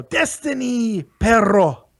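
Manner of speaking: drawn-out wrestler catchphrase, slow; a light nod to Alberto Del Rio; Alberto Del Rio impression. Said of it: "Alberto Del Rio".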